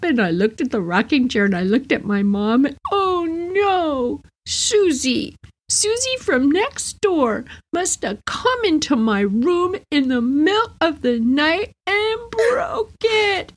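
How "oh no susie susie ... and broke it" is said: put-on very high, slow, teary child lying; crying little girl. Caught child tearfully blames Susie to her mom, crying high. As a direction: sob out the excuse like a caught kid.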